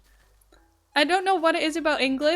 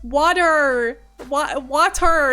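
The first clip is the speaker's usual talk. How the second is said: louder, slow, drawn-out "Water. Wa-, water."; overenunciated water; 7 dB louder, much slower than usual. Retold or imitated. American repeating her order for an English waitress, overenunciating. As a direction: spell it out with the mouth, syllable by syllable. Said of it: "I said it, like, three times".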